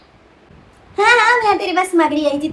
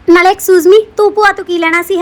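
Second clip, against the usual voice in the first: loud, sharp rebuke of the maid; irritated scolding; 2 semitones higher, 8 dB louder than usual. Acted. Madam loudly scolds the new maid, annoyed. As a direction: put the nosy servant in her place. Mistress snaps at nosy maid.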